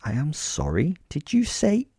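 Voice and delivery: haughty query leaping high on the last words; revolted disbelief. Acted. Narrator appalled at a boy's slang, revolted. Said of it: "expressing this with a face of revulsion".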